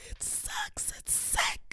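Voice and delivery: breathy hushed whisper. Pretend. Host whispering to listeners about her bad audio. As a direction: drop to a secret whisper.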